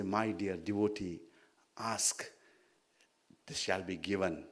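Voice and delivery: soft, low, unhurried blessing; God's gentle voice. Acted. Sage imagining God granting his wish, soft and low. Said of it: "stand in front of me and say".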